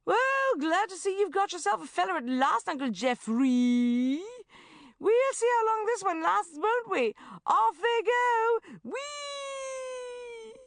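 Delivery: very high, loud sing-song taunt; mocking taunt. Shaz imagining the jibe at Uncle Geoffrey, high mocking shout. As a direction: jeer at him gleefully.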